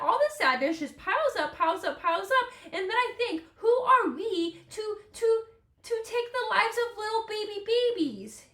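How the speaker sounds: high, plaintive wail of protest; sad. Real, not acted. Podcaster upset over baby animals, high and sad. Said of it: "It makes me so sad".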